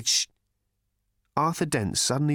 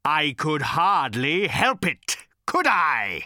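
higher, slow furious bellow; 6 semitones higher, 4 dB louder, slower than usual. A narrator's reading. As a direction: roar it with resentment. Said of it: "he bellowed".

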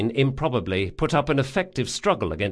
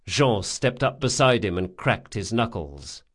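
ordinary delivery, nothing marked

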